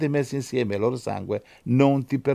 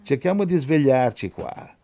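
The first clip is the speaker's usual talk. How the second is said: ordinary talk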